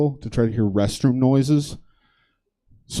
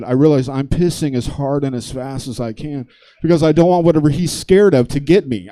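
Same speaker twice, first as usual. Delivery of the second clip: usual speaking voice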